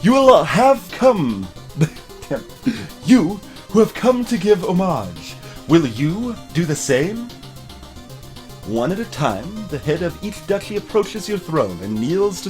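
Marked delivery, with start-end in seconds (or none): 0.0-7.3 loud, slow, raised herald cry; herald proclamation. Acted. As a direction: proclaim to the whole court. Herald presents the queen.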